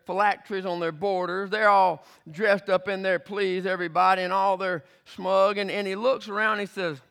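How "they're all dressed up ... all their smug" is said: ordinary delivery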